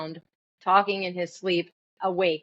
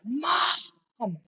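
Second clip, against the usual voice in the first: high-pitched yell at no real volume; mock yell; 11 semitones higher than usual. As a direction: imitate a daughter yelling for mom. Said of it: "she just yells".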